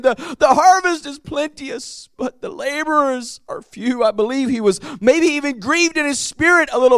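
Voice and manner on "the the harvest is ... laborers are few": higher, heartbroken cry of the verse. Acted. Preacher voicing Jesus grieving over few laborers, crying out. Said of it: "crying out and saying".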